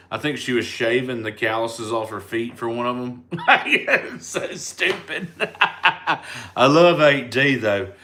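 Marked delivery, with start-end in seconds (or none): 3.3-7.9 real laughter through the words, slow; words dissolving into giggles; laughing. Real, not acted. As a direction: laugh while talking about Aunt D. Laughing about Aunt Dolores video.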